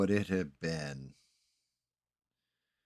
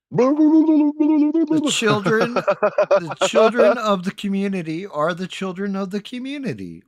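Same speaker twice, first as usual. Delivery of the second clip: mock dramatic oration; 12 semitones higher, 9 dB louder than usual. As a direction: declaim it like a grand speech. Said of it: "it looked just like this".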